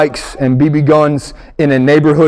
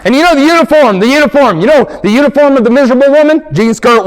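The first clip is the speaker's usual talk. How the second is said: raised mock preacher, higher and louder; 8 semitones higher, 3 dB louder, faster than usual. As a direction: preach at the congregation with heat. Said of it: "preacher voice".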